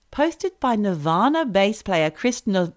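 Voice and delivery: ordinary talk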